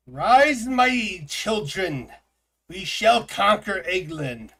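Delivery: high, slow, booming ruler's decree; swarm queen voice. Pretend. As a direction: proclaim like a queen to her brood. Commanding egg minions in game.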